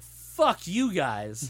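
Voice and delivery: loud, drawn-out retold cursing; comic indignation at the filmmakers; mock outrage. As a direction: blurt it high and stretch every word. Retold outrage at the film's ending.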